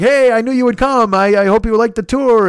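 high, loud, eager host welcome; glad-handing host. Retold or imitated. Restaurant host welcoming the guys back, high and eager. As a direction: greet them like the host who saw it coming.

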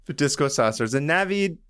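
ordinary delivery

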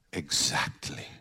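breathy knowing whisper. Retold or imitated. Woman whispering a knowing reply to him. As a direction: whisper it like a secret. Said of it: "and she goes".

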